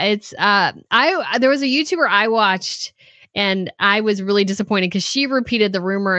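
ordinary talk

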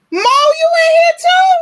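very high and loud; can't believe they showed up; thrilled squeal of surprise; delighted surprise. Real, not acted. Surprised by a viewer's arrival.

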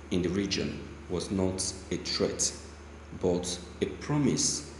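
measured, low news-reader delivery. Acted. Newscaster reading a Niger Delta protest statement, measured. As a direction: read the report steadily like a newscaster.

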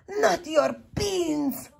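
outraged cry. A narrator's reading. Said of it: "cried Skipito, outraged".

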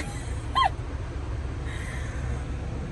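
laugh. Real, not acted. Laughing at the story.

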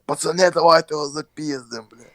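retold shout to the neighbours; mock shout. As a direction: yell it out to rally the boys. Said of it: "чувак кричить".

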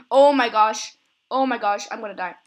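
loud burst of alarm; alarmed exclamation. Real, not acted. Player who is gonna die, loudly alarmed. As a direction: blurt it out in sudden alarm.

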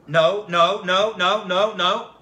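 a string of loud, impatient no's; loud dismissive no's. Retold or imitated. Father turning down the yeshiva boys, loud and impatient. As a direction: wave each boy off loudly. Father rejecting every answer.

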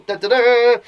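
high, loud sung-out fanfare. Acted. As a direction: announce with a triumphant "da-da-daa". Fanfare introducing Lizzie's comic.

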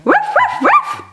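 very high yappy little barks; puppy bark. Pretend. The puppy answers the host, with very high yips. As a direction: yip like a tiny puppy.

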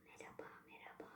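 soft breathy ASMR whisper. Shown as a demonstration. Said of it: "Asmurlar derler mi".

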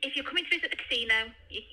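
ordinary delivery

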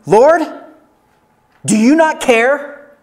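high, loud indignant complaint; a woman fed up, demanding; exasperated Martha. Acted. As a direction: voice Martha's outrage at Jesus. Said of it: "some women have real attitude".

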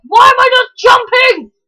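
high, loud mock-frustrated yell; retold frustration. Recalling pressing X with no jump, a high, loud frustrated yell. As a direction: replay your panic at the controller. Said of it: "I was like".

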